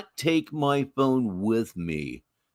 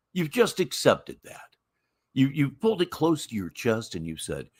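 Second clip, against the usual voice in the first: ordinary talk, nothing marked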